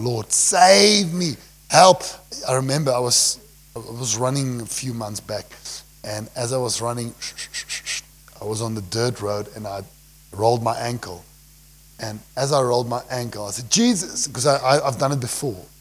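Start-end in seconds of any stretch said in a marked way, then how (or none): 0.0-2.0 drawn-out, raised plea for rescue; crying out. Acted. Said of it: "cried out". 13.7-14.3 high, sudden yelp of a name; retold cry. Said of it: "And I cried out".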